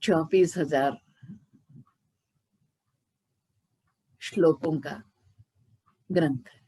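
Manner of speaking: dragged out word by word with long pauses; too slow demo. Shown as a demonstration. Guru shows listeners how too-slow speaking sounds, dragging each word. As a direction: show how painfully slow speech sounds. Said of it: "अगर मैं ऐसा बोलूं तो कैसे लगेगा?".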